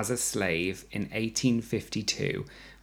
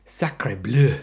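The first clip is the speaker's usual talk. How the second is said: mock French; 6 dB louder than usual. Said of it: "C'est delicious".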